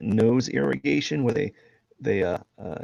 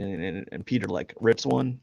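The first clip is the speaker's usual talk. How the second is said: ordinary talk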